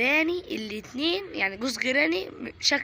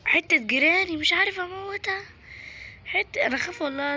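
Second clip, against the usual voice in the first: exasperated outburst; 8 semitones higher, 5 dB louder than usual. Real, not acted. Frustrated at unkillable Granny.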